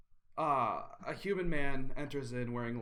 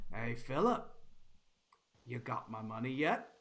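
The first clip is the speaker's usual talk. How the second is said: bandit voice. Acted.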